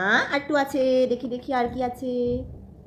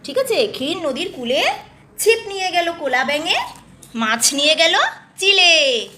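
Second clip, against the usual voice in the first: louder sing-song storybook voice; reading to a toddler; 6 dB louder than usual. Acted. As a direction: read it brightly to a little child.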